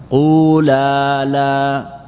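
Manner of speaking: قولا لا stretched slowly as quu-laa, laa; voweled lam. Shown as a demonstration. Teacher showing the lam now carries a vowel, drawn-out قولا لا. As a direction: hold each long vowel in قولا لا. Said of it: "إذن اللام صارت متحركة".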